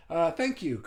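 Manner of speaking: ordinary talk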